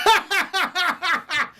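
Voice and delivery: high, manic put-on cackle; maniacal laughter. Host mimicking Ray Liotta laughing in Goodfellas, maniacal laughter. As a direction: laugh long and uncontrollably, hammed up. Acts out uncontrollable movie-theater laughter.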